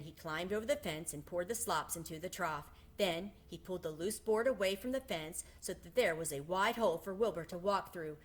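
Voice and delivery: ordinary delivery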